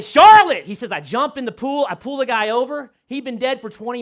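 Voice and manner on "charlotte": sudden loud panicked yell, higher; shouting for wife. Retold or imitated. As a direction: yell her name in alarm. Panicked call to wife at pool.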